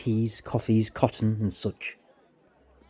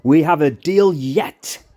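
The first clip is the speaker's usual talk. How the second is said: loud, slow, high exclamation; old Spaniard booming his agreement; exclaimed; 7 semitones higher, 9 dB louder, slower than usual. Acted. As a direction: seal the deal with a shout. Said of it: "exclaimed the old Spaniard".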